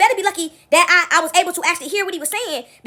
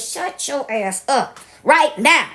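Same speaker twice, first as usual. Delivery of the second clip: very slow, word-by-word retold command; fed-up anger; 2 semitones lower, much slower than usual. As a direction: space out every word as a final warning. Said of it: "my blood pressure spiked up".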